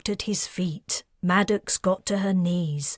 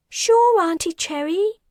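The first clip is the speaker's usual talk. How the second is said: put-on much higher, childlike voice; little girl; 12 semitones higher, 5 dB louder than usual. A narrator's reading. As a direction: answer brightly as a small child. Little girl answers her aunt.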